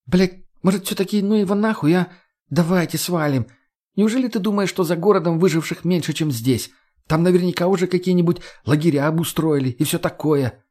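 high-pitched female character, urging; agitated young woman arguing; feisty Margo, pleading. Acted. Narrator voices Margo.

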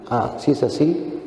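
receive them softly and kindly; gentle welcome. Acted. Jesus welcoming the faithful one, low and gentle.

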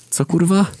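bewildered outburst; baffled. A narrator's reading. Narrator baffled by Jesus' sermon, shouting.

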